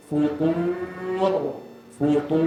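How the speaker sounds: futur with a thick full ta; heavy ta. Shown as a demonstration. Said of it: "Macam tu".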